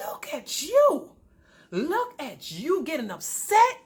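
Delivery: high, slow, drawn-out taunt; mocking disbelief. Wife calling out upset husband, mocking disbelief. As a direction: stretch the words in scornful amazement.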